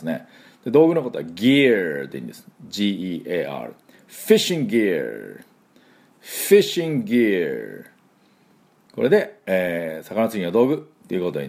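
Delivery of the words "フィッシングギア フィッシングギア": "fishing gear" said the English way; English pronunciation. Shown as a demonstration. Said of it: "Fの音と".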